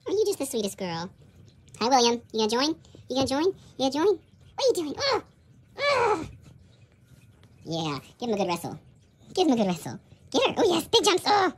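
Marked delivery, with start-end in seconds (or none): none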